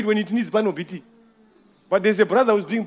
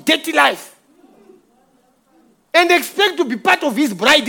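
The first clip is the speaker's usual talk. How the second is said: full force preaching; 3 semitones higher than usual. Acted. Pastor preaching to the brothers at full force. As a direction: preach it out, hammering each line.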